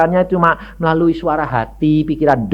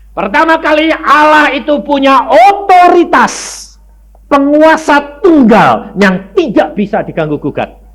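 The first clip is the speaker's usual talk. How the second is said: fiery preaching; 11 semitones higher, 7 dB louder than usual. Acted. Preacher proclaiming God to congregation, loud and high. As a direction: hammer each truth home to the congregation.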